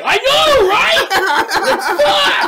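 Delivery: very high, loud excited squeal; hammed-up shouting in shared wonder; amazed agreement. Retold shared amazement with child.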